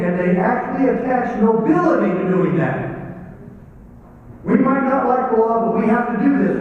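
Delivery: loud, high, driving sermon voice; fired-up pulpit delivery; preaching at full force. Acted. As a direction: preach it to the back row. Preaching against unjust law enforcement.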